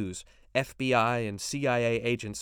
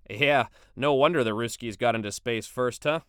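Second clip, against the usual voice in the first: neighbour Bill; 6 dB louder than usual. Acted. Neighbour Bill voiced, joking.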